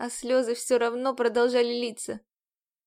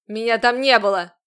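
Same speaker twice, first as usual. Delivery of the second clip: menacing shout; 9 dB louder than usual. A narrator's reading. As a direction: bark "Меня там не было" at her. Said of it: "Грозно прищурился Рузерт".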